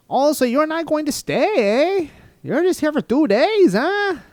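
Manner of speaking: put-on very high, loud caricature Canadian accent. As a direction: ham up a badly faked Canadian accent. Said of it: "dropped that Canadian accent".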